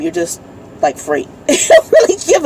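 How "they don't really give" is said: laughing. Real, not acted.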